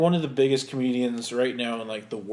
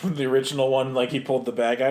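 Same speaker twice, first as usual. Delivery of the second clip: ordinary delivery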